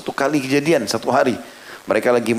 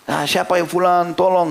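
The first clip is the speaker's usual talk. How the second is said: calling for help; 7 semitones higher than usual. Retold or imitated. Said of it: "Teriak-teriak".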